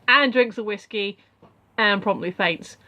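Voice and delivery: usual speaking voice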